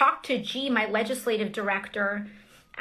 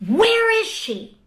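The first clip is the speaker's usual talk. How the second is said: higher, louder, slower imitated yell; retold shout; 11 semitones higher, 7 dB louder, slower than usual. Congresswoman retelling the man's shout, louder and high. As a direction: let the search call ring out, high and slow. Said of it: "I just start to hear these yells".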